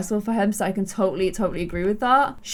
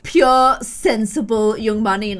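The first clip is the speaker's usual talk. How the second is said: normal talking voice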